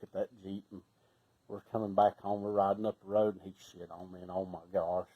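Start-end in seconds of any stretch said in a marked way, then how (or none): none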